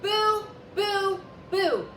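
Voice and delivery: loud, high boos; a ghost shouting boo to scare. A narrator's reading. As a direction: bark each boo.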